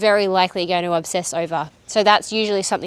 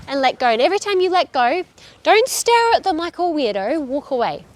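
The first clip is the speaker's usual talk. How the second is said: ordinary talk, nothing marked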